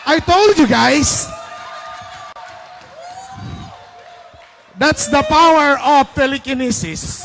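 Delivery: loud laughing boast; laughing gloat. Real, not acted. Winner laughing loudly at the team.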